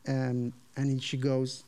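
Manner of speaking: ordinary talk, nothing marked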